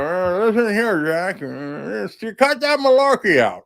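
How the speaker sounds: put-on a light, halting old-man Biden voice with a drawn-out uh; mimicking the elderly president scolding someone; Joe Biden impression. Host imitating Biden at a debate, lightly voiced old man. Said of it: "that's how he sounds".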